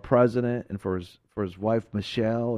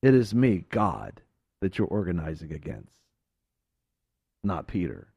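voice of God fading; 8 semitones lower than usual. Acted. God warns Absalom, fading to a low hush.

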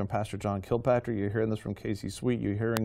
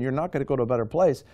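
usual speaking voice

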